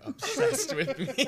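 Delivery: laughing. Real, not acted. Laughing retort.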